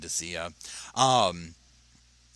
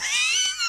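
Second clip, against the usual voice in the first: shrieking laugh. Real, not acted. Streamer cracking up at pregnancy symptoms, shrieking laugh.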